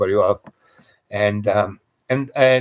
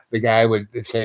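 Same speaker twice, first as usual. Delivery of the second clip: ordinary delivery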